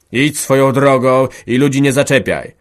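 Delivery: father yells a warning at his son; old man shouting. Acted. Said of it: "krzyknął stary".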